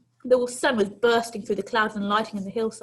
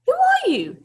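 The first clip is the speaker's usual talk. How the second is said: put-on high, loud old lady demanding who he is; old lady challenging; 9 dB louder than usual. Old lady confronting a runaway boy, loud and high. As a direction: snap the question up high and sharp.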